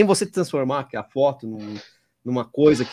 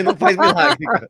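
mock exclaim; 11 semitones higher, 5 dB louder than usual. Real, not acted. Repeats joke louder for laughs.

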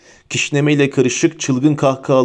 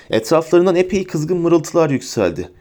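ordinary delivery

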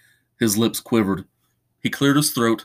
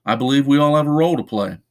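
loud; 4 semitones higher, 5 dB louder, faster than usual. Acted. Answering his grand claim.

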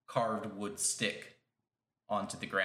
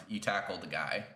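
ordinary delivery

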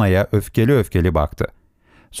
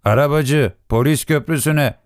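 lightly acted quavering call, pitched up; trembling voice; 6 semitones higher than usual. A narrator's reading. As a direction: hail a cab with a touch of tremble. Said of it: "Titrek bir sesle". (hafif titrek, tiz sesle seslenme)

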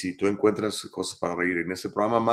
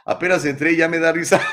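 laughing; 7 semitones higher, 8 dB louder, faster than usual. Real, not acted. Reading Héctor's comment aloud, laughing through it. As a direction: read it fast and loud through laughter.

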